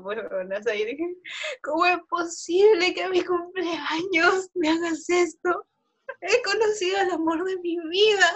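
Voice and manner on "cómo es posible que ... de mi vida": slow, high, tearful mock lament; dramatic drunk plea. Drunk birthday girl pleading with Yair, slow tearful lament. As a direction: ham up a drunken heartbreak. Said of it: "le hice un drama".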